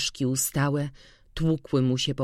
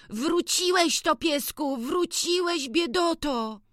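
put-on boy's voice much higher, full of glad relief; joyful exclaiming; 14 semitones higher than usual. A narrator's reading. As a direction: greet the lost dog with joy. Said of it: "wykrzykiwał".

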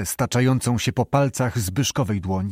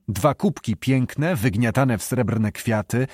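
plain reading voice

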